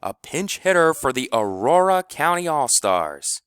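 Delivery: high, loud stadium call; grand announcer's cry; booming announcement. A narrator's reading. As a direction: proclaim it to the whole crowd. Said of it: "called out in his golden voice".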